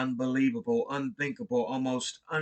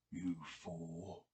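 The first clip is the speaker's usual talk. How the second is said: much lower, much softer hollow whisper; a remote unearthly thing speaking from the grave; low whispered voice from the tomb, hollow and inhuman; deep hollow inhuman voice; 7 semitones lower, 14 dB quieter than usual. Acted. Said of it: "the voice was deep, hollow, gelatinous, remote".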